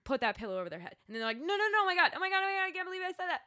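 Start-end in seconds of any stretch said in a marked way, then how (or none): 1.4-3.4 panicked. Retold or imitated. Mother horrified at own thought.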